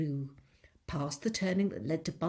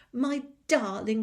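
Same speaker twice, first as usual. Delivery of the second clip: louder, higher glad greeting; joyful cry; 5 semitones higher, 8 dB louder than usual. Acted. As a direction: welcome him home with joy. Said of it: "cried Missus Fox".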